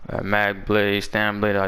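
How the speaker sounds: ordinary delivery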